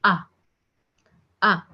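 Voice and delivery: "A" said softly and subtly, open ah; "A" as a soft, open French ah; French letter A. Shown as a demonstration. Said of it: "very soft, very subtle".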